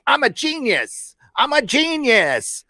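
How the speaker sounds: very high, slow smug squeal; smug squeak. Retold or imitated. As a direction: squeak it out with mock pride. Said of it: "and he's all".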